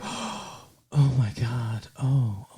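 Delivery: very soft, low imitation of mumbling; background mumbling. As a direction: murmur it as if heard faintly in the background. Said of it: "like, mumbling almost".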